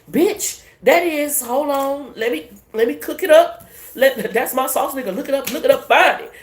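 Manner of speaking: high, loud, thrilled rush of words; hyped up. Real, not acted. Reader hyping herself up to viewers, thrilled and loud. Hyped up over her reading.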